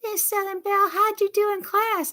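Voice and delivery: high puppet question; Minnie the puppet. Retold or imitated. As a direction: ask sweetly in the puppet voice. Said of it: "I had her to say".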